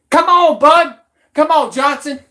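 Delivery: loud, high taunt calling him out; taunting shout. Real, not acted. Goading a rival to fight, loud taunting shout. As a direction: yell come on to goad him.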